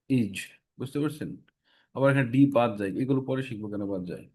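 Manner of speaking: usual speaking voice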